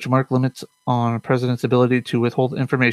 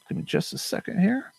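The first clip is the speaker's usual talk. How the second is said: normal talking voice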